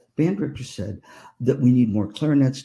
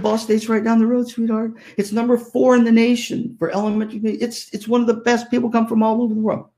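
sweet coaxing; 10 semitones higher than usual. Retold or imitated. Aunt coaxing niece.

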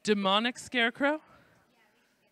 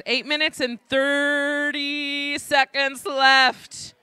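slow, raised time-check call; announcer call-out; 4 semitones higher, 4 dB louder, much slower than usual. Real, not acted. Host giving teams a time check, drawn-out announcer call. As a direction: stretch it out like an arena announcer.

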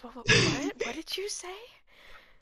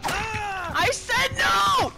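slow drawn-out furious no; dramatic shout; 6 semitones higher, much slower than usual. Acted. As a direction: howl it at the screen. Acted dramatic denial.

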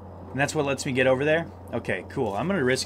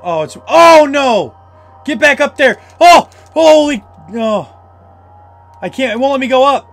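high, loud frantic cries; panicked yelling; 10 semitones higher, 11 dB louder than usual. Real, not acted. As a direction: scream at the screen in real panic. Panicked escaping monster in game.

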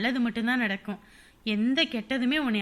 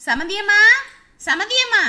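loud high call across the house; rising loud call to the in-law; 10 semitones higher, 10 dB louder than usual. Acted. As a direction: call her from another room. Calling out to in-law.

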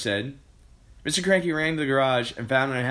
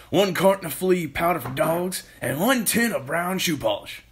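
higher-pitched, eager announcing voice; excited Mister Kilcranky; 8 semitones higher, 4 dB louder than usual. Acted. Mister Kilcranky listing ingredients to George, excited announcement. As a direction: call out each item with bouncy emphasis.

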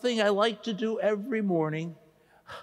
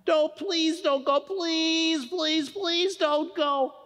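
pleading; 8 semitones higher, slower than usual. Acted. Begging friend not to go.

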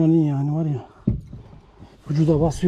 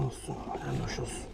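soft murmur; 13 semitones lower, 15 dB quieter than usual. Real, not acted. Forgiving the fish, murmuring.

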